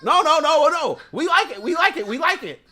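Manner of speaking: put-on whiny child begging for the juice; inner kid. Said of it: "Like the kid inside of you".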